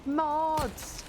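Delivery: calling out. Real, not acted. Streamer summoning the chat moderators, calling out.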